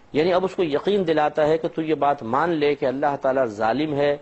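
ordinary delivery

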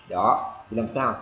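ordinary talk, nothing marked

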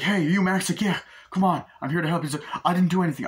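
high, loud hurried voices; lightly acted frantic dialogue; urgent exchange. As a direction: rush the lines amid gunfire. Detective finds Max in crowd.

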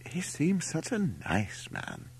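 high, soft, slow character voice; approving mother. A narrator's reading. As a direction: coo it high, slow and softly. Mother's approving remark in story.

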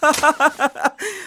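high, triumphant villain cackle; greedy man cackling over his stolen gold; evil cackle. Acted. Villain gloats alone over gold.

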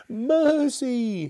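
high, loud cry for mercy; terrified plea. Acted. Scrooge on his knees before the ghost, terrified. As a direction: cry out in high-pitched terror.

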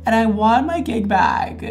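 high, loud playful complaint; mock indignation. Vlogger to idol with same hairstyle, mock indignation. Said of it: "and I'm like".

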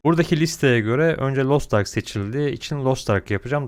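normal talking voice

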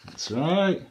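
ordinary delivery, nothing marked

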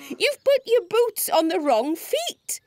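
high-pitched, amused exclamation; acted mirth, voice lifted; amused cry. A narrator's reading. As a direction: cry it out high, tickled by the mistake. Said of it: "cried Scoff".

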